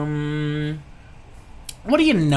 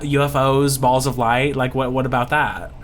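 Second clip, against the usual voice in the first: usual speaking voice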